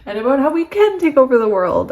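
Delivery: high, loud words through laughter; laughing excitedly. Real, not acted. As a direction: giggle it out, thrilled. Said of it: "I'm so excited".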